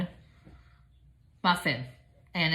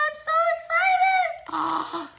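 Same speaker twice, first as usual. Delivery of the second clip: very high, slow squeal; drawn-out very high squeal; squealing excitement; much slower than usual. Real, not acted. Unboxing gift.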